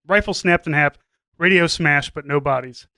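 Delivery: ordinary talk, nothing marked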